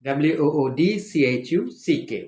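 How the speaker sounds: usual speaking voice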